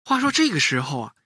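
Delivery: ordinary delivery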